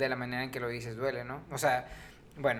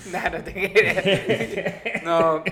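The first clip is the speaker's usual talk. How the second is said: laughing; 5 semitones higher, 10 dB louder, much slower than usual. Real, not acted. Laughing after a joke.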